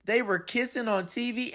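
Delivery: ordinary delivery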